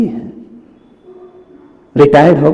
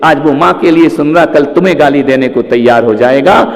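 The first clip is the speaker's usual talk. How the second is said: usual speaking voice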